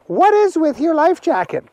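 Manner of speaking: a nosy little sister asking; curious Agnes. Acted. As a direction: sister quizzing Archie about the jacket, high. Agnes questions Archie.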